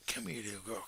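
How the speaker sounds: low soft whisper to the dog; hushed come here; whispered coaxing. Real, not acted. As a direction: gently whisper her over.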